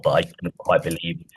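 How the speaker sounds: ordinary talk, nothing marked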